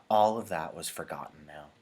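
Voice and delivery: usual speaking voice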